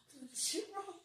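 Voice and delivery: high, hushed mock bewilderment; quiet, high-pitched and baffled; baffled self-talk. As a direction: squeak out your retold confusion softly. Retold baffled self-talk while watching.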